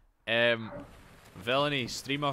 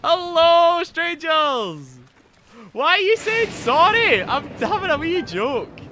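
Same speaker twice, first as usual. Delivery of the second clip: high, very loud excited holler; excited shouting; 12 dB louder, slower than usual. Real, not acted. Streamer greeting viewers and joking, excited shouting. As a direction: shout it out, pitched right up. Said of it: "I'm sorry for shouting".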